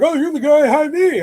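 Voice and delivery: high-pitched delighted recognition; excited recognition. Retold or imitated. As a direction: imitate an excited neighbour spotting someone. Neighbours recognising him, acted.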